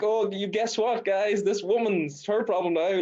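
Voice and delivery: mock gleeful. Telling Instagram followers the dog is gone, mock gleeful. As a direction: gloat at the camera, playing it up. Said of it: "I'm like".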